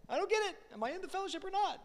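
high, mock-confused voice, fast at the end; voicing a confused listener, high and quick; confused congregant.

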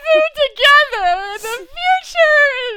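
high, loud mock sob; mock sobbing. Telling her family how she sobbed at the ending, mock wail. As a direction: wail it like a weepy fan. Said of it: "I'm, like, sobbing".

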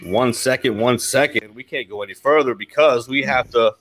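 usual speaking voice

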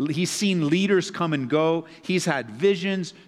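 usual speaking voice

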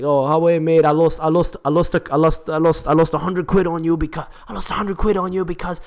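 high-pitched mock Geordie accent; stammering, high Geordie complaint. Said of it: "change my English accent from, like, Geordie".

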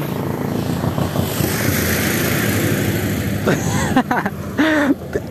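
long wheezing laugh rising high; breathless laughing fit; real laugh. Real, not acted. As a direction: lose it completely, almost silently at first. Cracks up after the sung line.

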